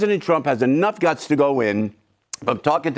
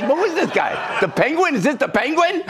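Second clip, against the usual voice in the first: ordinary talk, nothing marked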